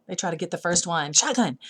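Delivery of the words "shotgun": calling shotgun. Retold or imitated. Kids calling the front seat.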